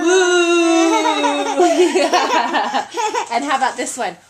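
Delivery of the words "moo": laughing moo. Real, not acted. Parent copies child's moo.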